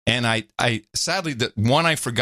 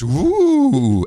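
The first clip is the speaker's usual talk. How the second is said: high, loud mocking ooh; 9 semitones higher, 6 dB louder than usual. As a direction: tease it with a big ooh. Mocking reaction again.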